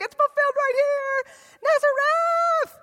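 high, loud cheering townsfolk; excited cheer. Retold or imitated. Townsfolk high-fiving over the prophecy, high excited cheer. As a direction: celebrate like you just won. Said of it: "giving high fives and fist bumps".

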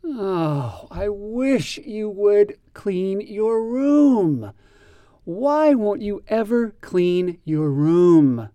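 slow, drawn-out frustrated groan; slow exasperated parent's groan. Shown as a demonstration. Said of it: "Here is an example of groaning".

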